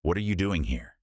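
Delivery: suspicious, talking down; condescending. A narrator's reading. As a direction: challenge him like an intruder. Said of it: "his voice weighted with condescension".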